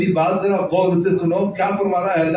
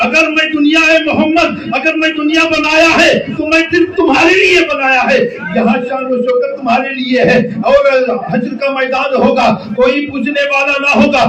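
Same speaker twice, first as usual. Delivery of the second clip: louder, higher impassioned sermon; fervent preaching; 6 semitones higher, 4 dB louder than usual. Real, not acted. Preacher stirring the gathering, full-voiced sermon. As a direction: preach it with rising fervour.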